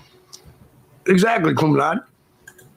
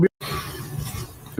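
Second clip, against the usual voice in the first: whispered aside; 11 dB quieter than usual. Real, not acted. Greeting viewer, tossed aside.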